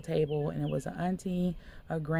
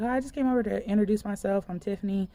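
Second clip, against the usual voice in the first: higher, faster, louder hello; bright quick greeting; 5 semitones higher, 7 dB louder, faster than usual. Retold or imitated. Visitor introducing herself to the girl, quick and high. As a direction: rush a friendly nervous intro.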